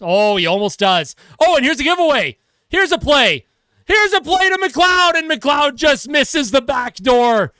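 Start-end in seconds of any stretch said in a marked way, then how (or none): 1.4-7.5 high urgent build to a shout; excited chance call. Real, not acted. Commentator calls a giveaway and near miss, excited shout. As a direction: raise the pitch with each phrase of danger. Calling a near goal live.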